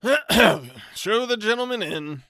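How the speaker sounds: slow, loud, put-upon bachelor; resigned bachelor. Acted. Bachelor resigned to receiving his cousin, slow and heavy. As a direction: drag it out in defeat. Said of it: "said the unfortunate bachelor".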